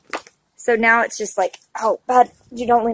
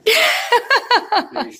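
bright burst of laughter; 12 semitones higher than usual. Real, not acted. Laughs at husband's joke.

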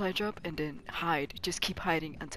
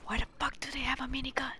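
fast tense whisper; hushed urgent warning; 6 semitones higher, much faster than usual. Real, not acted. Whispering while enemy near.

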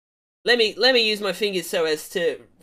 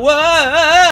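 high, loud drawn-out "whoa"; hammed-up careening cry; long yelled whoa; 10 semitones higher, 11 dB louder than usual. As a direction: yell it as if yanked along. Acts out grabbing on, chase.

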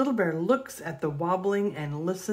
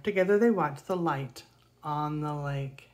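ordinary delivery, nothing marked

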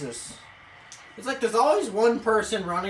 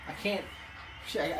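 ordinary delivery

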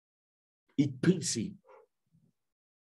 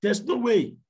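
much higher and louder, emphatic; preacher's cry; 9 semitones higher, 5 dB louder than usual. Acted. Preacher warning the congregation, high emphatic cry. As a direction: cry it out so nobody doubts it.

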